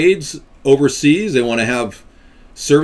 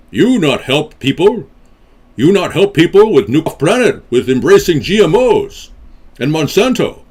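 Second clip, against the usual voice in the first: slow, louder, swooping put-on cadence; 3 semitones higher, 5 dB louder than usual.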